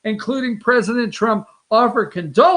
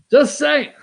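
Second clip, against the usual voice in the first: higher-pitched playful aside; mock-innocent shrug in the voice; teasing disclaimer; 5 semitones higher than usual. Real, not acted. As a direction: toss it off as a cheeky excuse. Repeats teasing disclaimer after joke.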